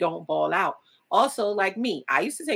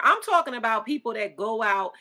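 ordinary talk, nothing marked

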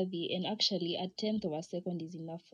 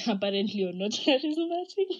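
breaking into laughter, higher and louder; laughing; 4 semitones higher, 8 dB louder than usual. Real, not acted. Podcaster teasing glasses wearers with the verdict, laughing. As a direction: laugh through the words, pitch climbing.

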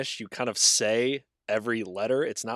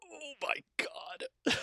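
dismayed gasp; 10 dB quieter, much slower than usual. Real, not acted. Reaction to hard name.

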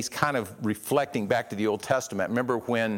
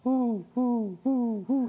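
dove cooing; 13 semitones higher, slower than usual. Retold or imitated. Preacher imitating doves murmuring, high cooing. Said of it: "it's used of doves cooing".

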